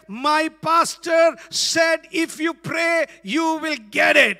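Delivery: put-on a boy sure of his faith; boy quoting the pastor, loud and sure; child's confident quote. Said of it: "My little boy said".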